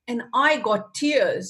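normal talking voice

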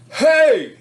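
sharp high yell from behind; shouted call. Retold or imitated. Bully on a bicycle calling after a boy, sharp high yell. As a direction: yell Hey to stop the kid.

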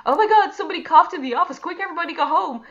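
high, loud mock alarm; hammed-up office panic. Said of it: "everybody's like".

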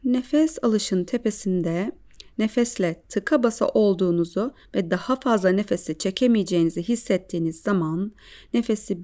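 calm guided breathing voice. Acted.

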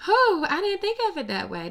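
a loud, high whew of real surprise; surprised whew. Real, not acted. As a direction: caught off guard by the idea, let it burst out. Struck by a messy new thought.

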